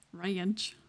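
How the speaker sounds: "ranch" said as "ray-anch"; stretched ranch vowel. Shown as a demonstration. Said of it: "R A Y A N C H".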